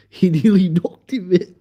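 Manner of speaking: words broken up by laughter; laughing. Real, not acted. Storyteller recalling the near knockout, laughing. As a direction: tell it while cracking up.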